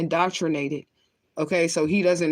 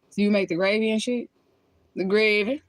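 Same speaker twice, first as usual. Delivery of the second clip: higher, playful New Orleans accent; 4 semitones higher than usual. Shown as a demonstration. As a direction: copy how New Orleans folks say gravy. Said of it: "my best New Orleans right there".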